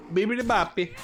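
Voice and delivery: sing-song cartoon Italian; mock Italian accent. Streamer hams up an Italian accent, high and loud. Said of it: "that was my Italian accent".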